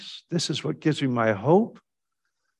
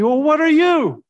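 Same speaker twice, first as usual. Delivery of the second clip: high, loud, throwing it back; sharp comeback; 8 dB louder than usual. Retold or imitated. Justin firing the question back at the preacher, loud. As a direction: turn the question on him. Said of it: "Justin says to me".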